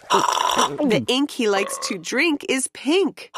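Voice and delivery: usual speaking voice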